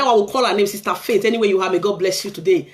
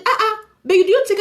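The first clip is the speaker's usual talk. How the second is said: surprised policeman; 10 semitones higher than usual. Retold or imitated. Policeman reacts to her story.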